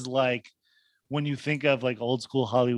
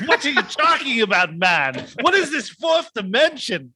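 high, loud put-on incredulity; mocking second grader; 9 semitones higher, 5 dB louder than usual. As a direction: act out a baffled schoolkid, pitched way up. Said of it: "they revert to, like, second graders".

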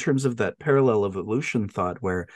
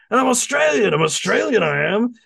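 hammed-up fake Australian, higher-pitched; mock Australian accent; 9 semitones higher, 4 dB louder, faster than usual. Quoting a riff on a miner, fake Australian accent. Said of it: "their main riff with that guy".

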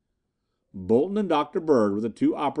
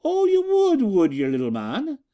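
very high, loud Irish woman's taunt; taunting Irishwoman; 12 semitones higher, 5 dB louder than usual. A narrator's reading. Irishwoman grabbing Bolton by the collar, taunting high. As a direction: sneer down at the little man. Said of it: "demanded the Irishwoman".

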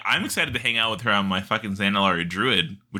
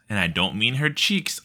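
ordinary delivery

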